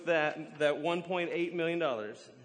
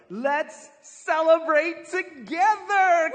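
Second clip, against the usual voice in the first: slow, shouted, higher call to celebrate; loud celebration; 10 semitones higher, 11 dB louder, much slower than usual. Acted. Master shouting to his servants to come celebrate. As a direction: belt it out like a party host.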